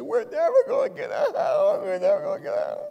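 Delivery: high, tearful imitation of a sobbing wife; imitating crying wife. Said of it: "and Lisa was just crying".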